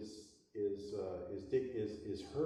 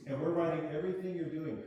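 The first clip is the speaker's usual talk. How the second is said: mock eager committee; 5 semitones higher, 5 dB louder than usual. Teacher acting out eager committee.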